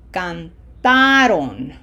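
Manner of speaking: slow canTAron, long loud TA; stress on middle syllable. Shown as a demonstration. Teacher shows how it sounds, cantaron stressed on a long TA. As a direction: stretch and punch the TA in cantaron. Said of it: "this is going to sound".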